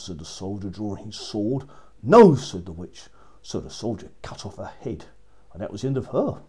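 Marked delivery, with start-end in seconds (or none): 2.0-2.4 a loud screamed no; defiant scream. A narrator's reading. Witch defies the soldier's sword, screaming. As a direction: scream the refusal back at him.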